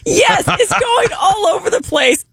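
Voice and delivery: high, squeaky laugh-talk; words squeezed out through laughter; telling the pie mess, cracking up. Real, not acted. Laughing while retelling chaos.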